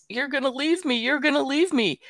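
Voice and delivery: high mock whine on repeat; imitated distressed child. Granddaughter repeating her fear to grandma, high imitated whine. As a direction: imitate a little girl's plaintive chant, pitched high. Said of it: "she just kept saying this".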